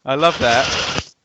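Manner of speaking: ordinary talk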